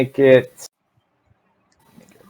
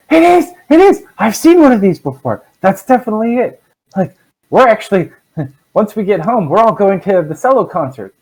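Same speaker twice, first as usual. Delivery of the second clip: high-pitched, thrilled exclamations; excited halfling; 9 semitones higher than usual. Acted. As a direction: burst out in delighted recognition, voice pitched way up. GM acts out awestruck halfling.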